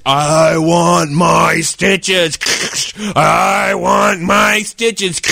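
very slow, drawn-out zombie moan; monster voice. Retold or imitated. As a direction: groan it like a headless monster. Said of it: "Like that".

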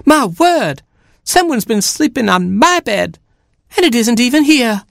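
very high, aghast mother hen; Mama Hen. A narrator's reading. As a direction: exclaim in a high, flustered hen voice. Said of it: "She then looked for her bed".

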